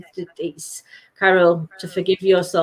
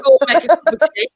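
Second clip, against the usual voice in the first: fast, louder mock command; mock bossy order; 7 semitones higher, 5 dB louder, faster than usual. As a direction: rattle it off like a pushy order. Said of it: "I didn't say it in that tone".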